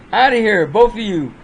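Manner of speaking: a mom barking both of them out the door; mock angry order. Mom throws son and boyfriend out after their fight, loud mock anger. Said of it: "she kicks them both out".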